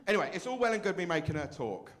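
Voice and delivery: ordinary talk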